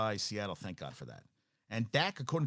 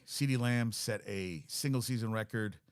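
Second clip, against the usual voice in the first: usual speaking voice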